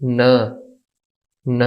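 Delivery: न as "nuh", level and unaccented; neutral tone demo. Shown as a demonstration. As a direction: model न with a schwa vowel. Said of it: "तो इसकी pronunciation होगी".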